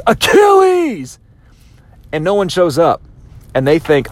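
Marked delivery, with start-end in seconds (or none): 0.1-1.2 very high, drawn-out shout of the name; king calling out. Retold or imitated. Greek king summons Achilles before both armies, long loud call. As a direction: call the hero across the battlefield, slowly. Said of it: "the other king from the Greek side goes".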